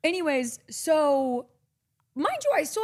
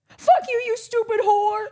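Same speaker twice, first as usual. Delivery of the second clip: screamed insult; 11 semitones higher, 6 dB louder, slower than usual. Retold or imitated. Girlfriend cursing her over phone.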